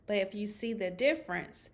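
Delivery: ordinary delivery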